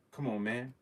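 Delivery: usual speaking voice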